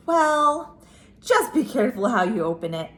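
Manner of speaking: plain reading voice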